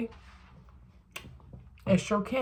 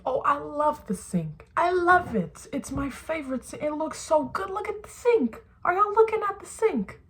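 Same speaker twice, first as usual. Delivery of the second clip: gushing over the sink; 7 semitones higher, 4 dB quieter than usual. Retold or imitated. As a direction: gush much higher, as if showing off a treasure. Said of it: "I like to be like".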